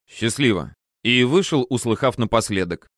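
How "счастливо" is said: usual speaking voice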